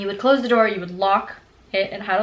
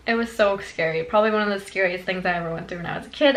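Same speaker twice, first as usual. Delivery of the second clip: normal talking voice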